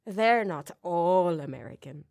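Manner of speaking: slow, badly faked Irish accent; accent slipping into Irish. Shown as a demonstration. Host plays with accents about the cast, mock Irish accent. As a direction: let the fake British accent slide into Irish. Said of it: "now I'm just Irish".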